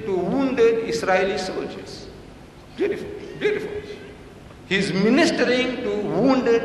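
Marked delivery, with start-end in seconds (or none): 2.8-3.7 high, breathy mock admiration; sarcastic praise. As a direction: coo it with sarcasm. Sarcastic aside on the photos.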